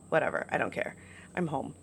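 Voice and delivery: whispered, dismissive aside; half under her breath; muttered aside. Real, not acted. Quiet aside to herself.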